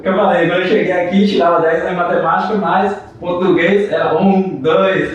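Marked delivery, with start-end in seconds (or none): none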